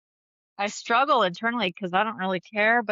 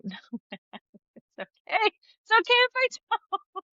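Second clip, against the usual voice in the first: high, laughing through the words; laughing at herself about not making dinner. Real, not acted. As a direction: giggle it out, half embarrassed. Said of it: "I don't know why I'm laughing".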